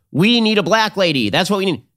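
high-pitched mocking demand; mock media plea. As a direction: parody a pundit's insistence, pitched well up.

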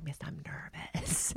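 slow, soft whispered confession; whispering. Retold or imitated. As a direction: hush it like a nervous secret. Confiding nerves to a teammate.

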